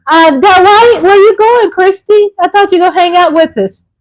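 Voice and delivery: high, loud doting cries; excited gushing. Real, not acted. Mother loudly dotes on her daughter arriving.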